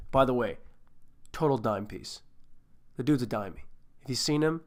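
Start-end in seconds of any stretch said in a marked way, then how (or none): none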